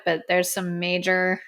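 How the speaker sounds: ordinary delivery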